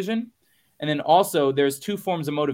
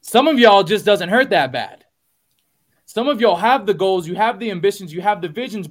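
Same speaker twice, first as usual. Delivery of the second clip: forceful preaching; 5 semitones higher, 7 dB louder than usual. Real, not acted. Host preaching at the audience, loud and forceful. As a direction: hit them hard with the point.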